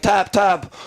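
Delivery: high, pressing call to tap; urgent call. Retold or imitated. Coach at cageside urging fighter to tap, high call. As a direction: urge him to tap out now. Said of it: "He's like".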